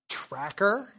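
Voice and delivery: "Tracker?" with a sharply rising question; incredulous echo. Acted. Lecturer catching Boromir's barb at Aragorn, incredulous. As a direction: question the word in mock disbelief. Echoes Boromir's barbed word, astonished.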